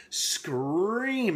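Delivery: "scream" stretched long with swooping pitch; drawn-out SCREAM. Retold or imitated. Reviewer retelling Ben's agony, "scream" slowly drawn out. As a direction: stretch "scream" into a mild mock cry. Draws out the word, miming the scream.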